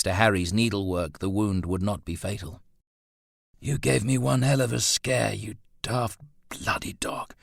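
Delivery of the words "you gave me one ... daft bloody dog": gruffly. A narrator's reading. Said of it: "Roland said gruffly".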